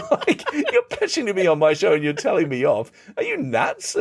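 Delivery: high, laughing disbelief; laughing incredulity. Real, not acted. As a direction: laugh at how absurd it was.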